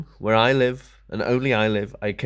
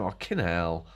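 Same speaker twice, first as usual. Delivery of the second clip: low muttered pun; 6 semitones lower, 7 dB quieter than usual. Shown as a demonstration. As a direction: drop the voice and mutter Kinnell. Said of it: "So now I say it all the time".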